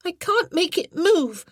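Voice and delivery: put-on a boy straining at a stuck door; frustrated boy. A narrator's reading.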